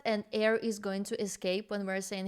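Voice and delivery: ordinary delivery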